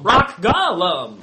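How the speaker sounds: slow, loud drawn-out shout; loud outburst. Real, not acted. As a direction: bellow the name out. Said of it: "was terrible".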